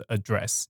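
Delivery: "address" as uh-DRESS, stressed on the second syllable; British noun "address", weight on DRESS. Shown as a demonstration. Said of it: "如果是英式英语". (英式念法，address 重音放在第二音节)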